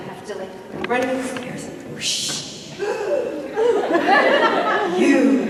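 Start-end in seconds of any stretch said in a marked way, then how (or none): 2.5-4.9 sharp high laughing gasp; hammed-up audition gasp; dramatic gasp. 5.0-5.5 loud hammed-up "You."; mock dramatic accusation. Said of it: "I had to say".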